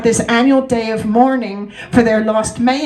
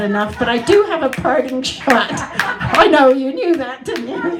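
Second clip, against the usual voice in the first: laughing; 3 semitones higher than usual. Real, not acted. Laughing while teasing audience.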